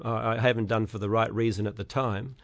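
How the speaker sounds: ordinary talk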